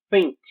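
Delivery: "think" with an unvoiced English th; English th sound. Shown as a demonstration. Teacher modelling a sound Portuguese lacks, English th. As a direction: say "think" with the th brought out. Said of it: "do T H".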